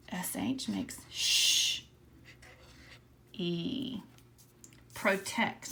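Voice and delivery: slow whispered letter sounds; slow phonics whisper. Shown as a demonstration. Teacher whispering the sh sound to the class. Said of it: "Do you remember?".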